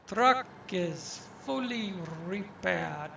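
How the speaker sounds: a bad mock Russian accent; hammed-up fake Russian accent; horrible Russian accent. Gamer reading the game's message in a bad Russian accent. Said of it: "That's my horrible Russian accent".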